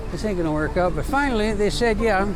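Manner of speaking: ordinary delivery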